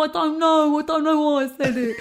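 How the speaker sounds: lightly voiced sheepish heckler, a laugh in it; a hint of the embarrassed heckler, high. Heckler fumbles an excuse to the comedian, sheepish and laughing.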